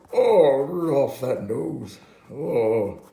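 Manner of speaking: loud, high hammed-up amazement; Santa. Santa sees Rudolph's nose glowing, loud mock amazement. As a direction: gasp it out big, starting high and easing down. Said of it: "he sees Rudolph, and Rudolph's nose".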